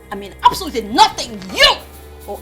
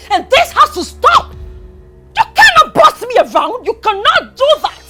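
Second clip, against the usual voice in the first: loud, high-pitched screaming defiance; enraged, raised-voice outburst; furious tirade; 8 semitones higher, 7 dB louder than usual. Acted. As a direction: yell it, refusing to be bossed around. Tirade against being ordered around.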